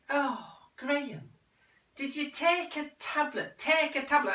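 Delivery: high, coaxing mother; mother's voice. Acted. Mother urging son to take his tablet, high and coaxing.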